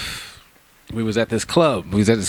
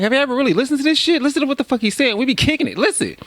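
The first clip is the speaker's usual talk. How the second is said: much higher, fast and eager; excited high urging. Retold or imitated. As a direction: pitch it up and hype them. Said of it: "I'm like".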